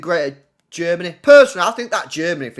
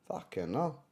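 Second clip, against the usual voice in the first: ordinary talk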